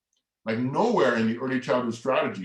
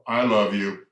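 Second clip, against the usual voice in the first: a louder, weightier "I love you"; subtext shown by inflection; 2 semitones lower, 6 dB louder than usual. Shown as a demonstration. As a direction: say "I love you" with force, changing its meaning. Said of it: "if I say the same words".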